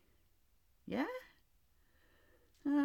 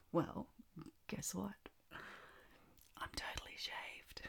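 hushed, voiceless whisper; 12 dB quieter than usual. Acted. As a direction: breathe it right in the ear. Intimate whisper.

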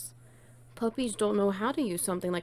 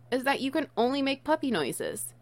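ordinary delivery

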